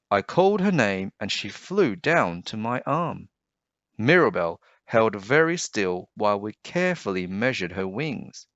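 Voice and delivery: put-on lively storybook reading for kids; story narration. A narrator's reading. Teacher reading a picture book aloud to children, lively storyteller voice. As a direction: read it like bedtime story time.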